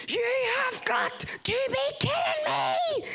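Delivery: high, slow furious yell at a jammed emergency door; furious shout. Retold or imitated. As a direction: blow a gasket at the jammed door. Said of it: "I blew a gasket".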